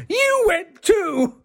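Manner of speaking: high, drawn-out mock squeal; astonished. Actress at dinner learns of shared teacher, astonished squeal. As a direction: squeal it in amazed disbelief, stretched out. Acting out friend's astonished reaction.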